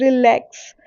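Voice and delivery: light रि, forceful lakhs in relax; stress on second syllable. Shown as a demonstration. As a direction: put the weight of relax on lakhs. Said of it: "lakhs पे मैंने ज़्यादा force दिया". (Relax में रि हल्का, लैक्स पर ज़ोर)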